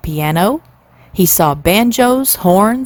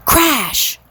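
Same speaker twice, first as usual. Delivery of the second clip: glass crash; 8 semitones higher than usual. A narrator's reading. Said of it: "went the splinters of flying glass".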